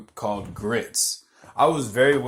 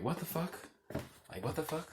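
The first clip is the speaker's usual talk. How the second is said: high, hushed disbelief; baffled reaction; 5 semitones higher, 12 dB quieter than usual. Retold or imitated. Neighbor looking at the woman across the street, baffled. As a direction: softly squeak out the confusion, voice lifted.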